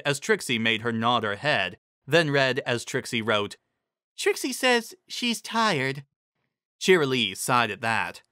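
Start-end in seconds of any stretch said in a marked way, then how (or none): 4.2-6.0 high, slow, simple-minded pony voice; high slow Ditzy. A narrator's reading. Ditzy reading Trixie's riddle to the group, high and slow. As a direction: drag it out high and dopey.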